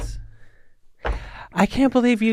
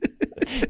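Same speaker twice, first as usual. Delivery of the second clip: high, softer helpless laugh; real giggles; 7 semitones higher, 8 dB quieter than usual. Real, not acted. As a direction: lose it mid-sentence. Cracking up at a remembered bit.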